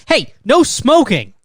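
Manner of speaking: high, loud, slow scold; mock stern warning. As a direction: bark it like a flight attendant. Mock flight attendant scolding.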